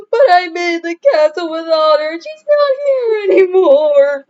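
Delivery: high, loud sobbing; sobbed. A narrator's reading. Franklin sobbing to his father about Otter. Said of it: "sobbed Franklin".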